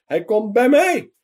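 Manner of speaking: high, loud, jubilant cry; overjoyed shout of a little man running home; cheering shout. A narrator's reading. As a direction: shout it out with pure joy. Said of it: "schreeuwde en juichte".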